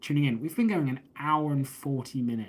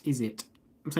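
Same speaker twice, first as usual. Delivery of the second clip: normal talking voice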